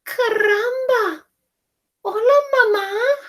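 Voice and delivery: very high, loud chick voice; a tiny new chick; baby chick voice. Acted. Chick greeting Jack as its mother. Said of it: "and said".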